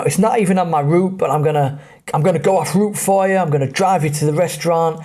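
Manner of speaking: a lightly voiced enthusiastic bus driver; friendly bus driver. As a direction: play the chatty driver going off route for the lads.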